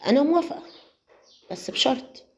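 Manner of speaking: a sly agreement with a catch. Acted. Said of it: "قالت له وبخبث".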